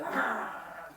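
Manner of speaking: low rolling growl; rolled angry "arr" sound effect; king's growl. Shown as a demonstration. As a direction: growl like a bad-tempered king. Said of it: "you go".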